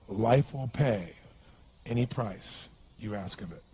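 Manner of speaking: slow, low and soft, each word weighed; solemn hushed wisdom. Retold or imitated. To the begging man, slow hushed solemn advice. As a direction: hand it over like a quiet blessing.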